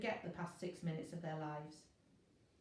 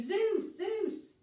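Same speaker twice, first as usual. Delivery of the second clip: frantic calling; 12 semitones higher, 7 dB louder than usual. Acted. Virgo screaming for help.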